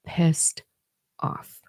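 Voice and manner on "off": normal talking voice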